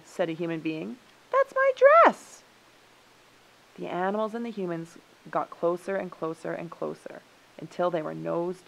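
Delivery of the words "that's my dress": very high, loud, slow protest; outraged high-pitched claim; indignant squeal. A narrator's reading. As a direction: squeal it out, drawing out the words. Human claims the dress.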